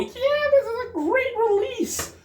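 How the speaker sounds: high, slow, over-the-top party cheer; mock hype voice. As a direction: ham up a gushing release announcement. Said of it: "you can't throw a party and go".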